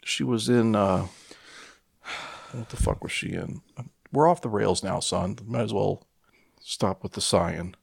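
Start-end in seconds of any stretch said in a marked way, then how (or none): none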